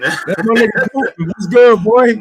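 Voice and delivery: loud joking Russian voice; playful fake Russian accent; mock Russian accent. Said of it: "my Russian accent".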